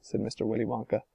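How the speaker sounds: ordinary delivery, nothing marked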